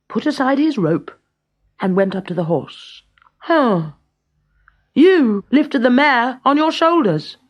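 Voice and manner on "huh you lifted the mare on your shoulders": put-on higher, louder, cocky boy voice; a boy outsmarting the giant; high, smug young hero; mocking boy. A narrator's reading. Boy Avram's voice.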